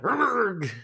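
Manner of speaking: very high loud strangled rrrg; rat death croak. Pretend. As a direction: croak like a rat keeling over. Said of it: "if a rat went".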